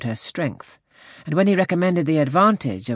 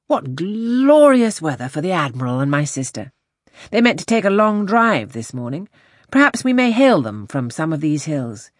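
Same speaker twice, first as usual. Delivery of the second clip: animated gentleman, raised pitch and louder; hearty enthusiasm; 5 semitones higher, 4 dB louder than usual. A narrator's reading. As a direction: chat away brightly as a hearty captain. Wentworth's cheerful chat in read story.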